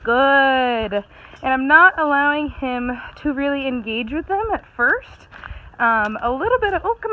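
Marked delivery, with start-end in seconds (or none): 0.0-1.0 a loud, bright "Good" to reward the dog; loud praise. Real, not acted. Praising dog during training.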